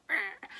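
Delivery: short high loud "Rah!" roar; mock angry roar. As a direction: mimic his bottled-up rage as a burst. Husband's inner rage imitated.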